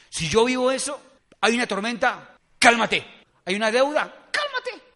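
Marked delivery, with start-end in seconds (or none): 2.6-3.0 loud, short order; firm command. Shown as a demonstration. Believer ordering a storm to settle, firm loud command. 4.3-4.8 very high, small put-on voice; comic squeak of an order; squeaky command. Shown as a demonstration. Believer ordering a debt to settle, squeaky command.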